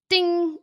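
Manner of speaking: high bright ding sound; mock ding. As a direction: make the brain go ding. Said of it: "my brain just".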